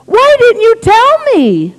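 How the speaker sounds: high, loud, drawn-out complaint; exasperated outcry. Retold or imitated. Husband demanding why wife kept it secret, exasperated outcry. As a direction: wail the question in mock frustration.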